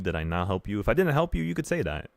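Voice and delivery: normal talking voice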